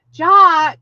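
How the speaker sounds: loud, high mock scold of a name. Real, not acted. Teasing a viewer over her guess, loud mock scold.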